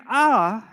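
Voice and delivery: an open "a" vowel, held briefly; a sound. Shown as a demonstration. Teacher sounding out "a" for students. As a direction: voice the vowel "a" clearly. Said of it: "if you hear an".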